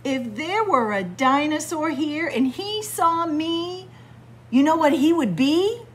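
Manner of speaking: a lightly voiced cocky shark bragging; boastful shark. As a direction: brag like a proud little shark.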